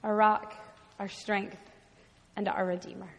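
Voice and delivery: soft, reverent prayer falling to a hush; opening prayer. Real, not acted. Preacher leading the congregation in prayer, softly reverent.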